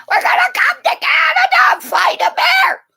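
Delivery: high, loud yelled imitation; mocking tourists. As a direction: yell it out as a boastful tourist. Mocking visitors planning a bear fight.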